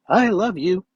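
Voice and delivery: excited. Shown as a demonstration. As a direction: burst out with it, delighted. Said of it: "there's different ways to share the word love".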